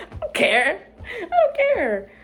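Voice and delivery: sing-song "I don't care" through laughter; laughing mock indifference. Mock carefree repeats with laughter.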